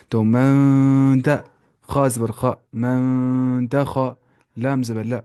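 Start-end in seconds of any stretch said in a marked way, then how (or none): 0.2-1.4 loud, very slow "من", hummed before "دا"; nasal ikhfa. Shown as a demonstration. Teacher repeats the rule in sound, long nasal ikhfa. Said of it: "جو کہ حرف اخفا میں سے". 2.7-4.1 slow "من" hummed in the nose, then "دا خا"; nasal ikhfa. Shown as a demonstration. Teacher builds up the word, slow nasal ikhfa. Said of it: "جو کہ حرف اخفا میں سے".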